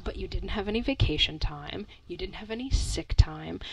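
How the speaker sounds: unvoiced whisper. Real, not acted. Cohost whispering backstory to listeners, real whisper. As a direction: lean in and whisper it.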